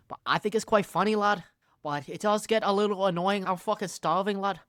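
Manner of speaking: lightly put-on Scouse lilt; Scouse accent. Fan imitates a YouTuber randomly breaking out, mock Scouse accent. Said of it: "break out into a Scouse accent".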